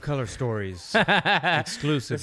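drawn-out, hammed-up show intro; playful promo voice; mock announcer. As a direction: announce it like a big segment title. Mock announcing a podcast segment.

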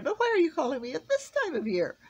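high, loud, eager question; rather excited. A narrator's reading. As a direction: ask with rising excitement. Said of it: "getting rather excited".

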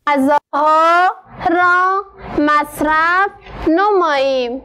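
very slow, word by word with long pauses; teacher dictating slowly, word by word; slow dictation reading. Shown as a demonstration. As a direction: dictate each word slowly for the pupils to write.

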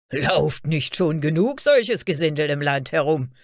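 loud, contemptuous guard; scornful shout. A narrator's reading. Guard derides vagrants roaming the land, scornful shout. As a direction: call it out with open scorn. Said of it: "rief einer der Wächter".

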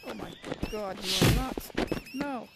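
loud frantic pleading; desperate rising panic; panicked. Real, not acted. Panicking as enemy attacks.

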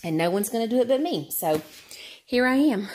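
normal talking voice

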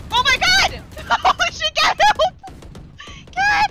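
loud, high panicked yelling; panicking gamer. Real, not acted. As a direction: shout in alarm, half laughing. Sudden scare during game.

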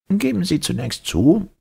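high-pitched, dry professor; dry lecturer's tone. A narrator's reading. Professor quizzing the captain, dry lecturing tone. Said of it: "in trockenstem Dozententone".

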